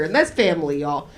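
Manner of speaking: ordinary delivery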